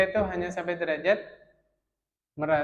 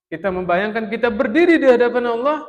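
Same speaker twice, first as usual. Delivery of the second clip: fervent preaching; 7 semitones higher, 13 dB louder than usual. Real, not acted. Preacher urging the congregation.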